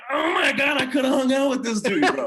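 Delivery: loud, very high wail of regret; anguished regret. Retold or imitated. Storyteller realizing he could have hung out with player, anguished. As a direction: relive the agony of a missed chance, half laughing. Retold regret at missed chance.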